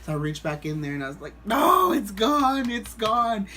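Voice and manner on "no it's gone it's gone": drawn-out, raised cry of dismay; freaking out. Retold or imitated. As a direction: wail it slowly in mock panic. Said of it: "And I'm freaking out".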